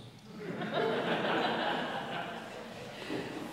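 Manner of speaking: a long, breathy laugh; hearty laugh. Real, not acted. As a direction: laugh it off warmly. Audience question, speaker laughs.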